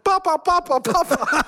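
licht nagedaan kinderstemmetje dat papa roept; hoog, blij roepend jongetje; kind dat roept. Retold or imitated. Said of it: "een van die kleine jongens". (kind dat naar zijn vader rent en roept)

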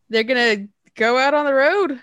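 hiccuping while talking; with hiccups. Real, not acted. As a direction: hiccups breaking the words. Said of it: "I have the hiccups".

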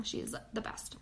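a breathy whispered afterthought; soft whispered sign-off on her recommendation. Real, not acted.

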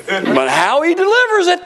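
"how he delivers it" swelling high and loud; mock disapproval. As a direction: climb steeply and loudly through "how he delivers it". Mock critic of preacher's delivery.

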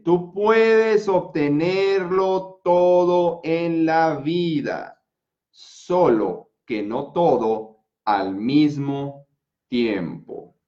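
very slow, every syllable drawn out; slow motion. Shown as a demonstration. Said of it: "hasta en cámara lenta te lo voy a decir". (en cámara lenta, muy despacio)

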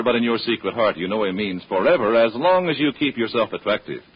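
ordinary talk, nothing marked

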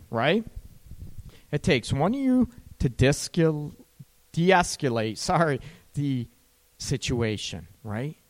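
usual speaking voice

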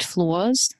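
"flaws" in an Australian accent. Real, not acted. Asking about loving your perceived flaws, Australian accent. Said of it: "such an Australian word".

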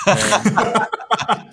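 slow, high laughing drawl; stoner. Pretend. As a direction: stretch it out, giggling. Says he's really high.

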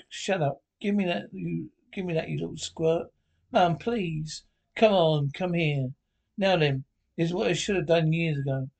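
usual speaking voice

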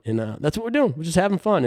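ordinary talk

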